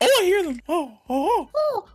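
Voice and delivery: high cooing bird noises; high mock pigeon oh-oh-oh. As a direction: coo like a pigeon. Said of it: "That's what you sounded like".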